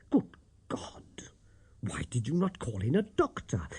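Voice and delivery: whispered alarm; aghast under his breath; hushed shock. A narrator's reading. Watson shocked at the landlady's news, whispering.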